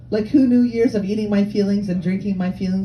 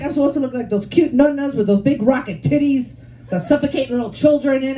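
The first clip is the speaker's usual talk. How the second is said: ordinary delivery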